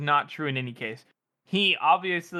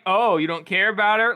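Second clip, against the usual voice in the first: louder, higher mock-doubting jab; teasing disbelief; 5 semitones higher, 5 dB louder than usual. She gives him shit about his assistant, teasing disbelief. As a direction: needle him with raised, acted incredulity. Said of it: "that's the kind of vibe she's getting".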